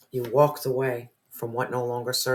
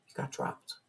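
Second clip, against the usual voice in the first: whispered, dropping to a hush; 12 dB quieter than usual. Real, not acted. Reader whispers to the viewer, hushed. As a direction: let it fall to a whisper.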